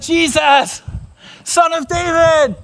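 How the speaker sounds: high, drawn-out cry; slow pleading shout over a crowd; calling out to Jesus. Acted. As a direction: call out urgently, pitched high. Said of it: "And he said".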